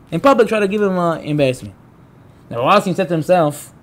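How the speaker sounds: ordinary talk, nothing marked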